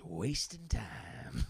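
put-on very slow, drawn-out old man's voice; imitated old-timer, unhurried; Bay. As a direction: stretch the words out as a knowing punchline. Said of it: "he goes".

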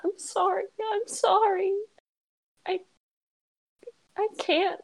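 high, tearful I'm sorry; crying apology. Acted. Crying girl apologizing to her friend, high and tearful. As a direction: plead through tears. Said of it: "she's beginning to cry again".

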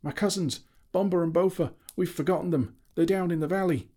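a dwarf remembering his kin in panic; dwarf crying out for cousins left below. A narrator's reading. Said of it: "Beaver gave a cry".

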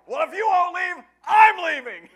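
very loud, high-pitched outburst; full-force angry bellow; yelling at full volume. Retold or imitated. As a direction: shout it like storming out. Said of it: "I yelled as loud as I could".

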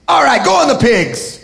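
high, offhand command; comic acted concession; exasperated Jesus. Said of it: "He's like".

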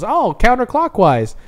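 put-on high, slow kid's voice; retold kid's answer. Host retelling Bart's prank call, high kid voice. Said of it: "And he goes".

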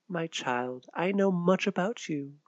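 whispered, knowing and soft; hooded woman whispering to the girl; mysterious whisper. Acted. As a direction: let her secret slip in a hush.

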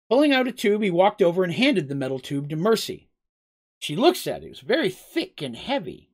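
ordinary delivery

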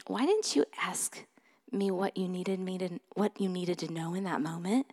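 soft, gentle questioning; the Lord speaking. Retold or imitated. The Lord to a convicted mom, softly questioning. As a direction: ask it quietly, as a tender reproof. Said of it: "And the Lord said".

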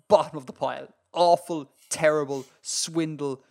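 usual speaking voice

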